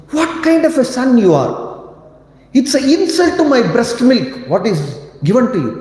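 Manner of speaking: high, scolding mother; a stern rebuke to her son; mother scolding Hanuman for failing her; angry mother. Retold or imitated. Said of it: "Then she says".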